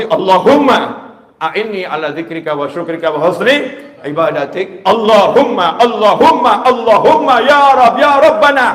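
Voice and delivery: high loud pleading invocations; fervent prayer call. Shown as a demonstration. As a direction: plead upward to God, rising each time. Demonstrates calling God before asking.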